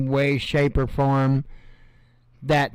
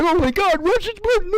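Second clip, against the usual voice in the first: panicky prepper; faster than usual. Retold or imitated. Mocking another prepper channel, high squeaky alarm. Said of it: "the other ones are like".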